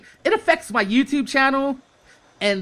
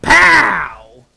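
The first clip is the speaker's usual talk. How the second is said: sudden loud punch on pow; shouted pow; 8 dB louder than usual. Real, not acted. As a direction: slam the pow like a hit. Reality check hitting him.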